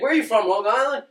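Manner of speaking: lightly voiced drunk Irishman questioning someone; drunk Irish guy. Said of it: "there was a drunk Irish guy running around".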